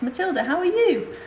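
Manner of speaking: high, laughing hello; delighted greeting. Retold or imitated. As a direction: greet an old patient with joy. Another nurse greeting warmly.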